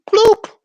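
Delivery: high, loud playful bloop like a button press; bloop sound effect. Retold or imitated. Telling off rude callers, she mimics the mute button with a loud high bloop. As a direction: pop out a cartoon mute noise.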